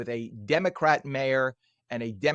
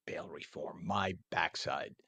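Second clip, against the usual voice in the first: scornful whisper; 9 semitones lower, 11 dB quieter, slower than usual. Real, not acted. Host scoffing at bail reform, whispered scorn. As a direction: drop to a hushed, scornful aside. Muttered dismissal after rant.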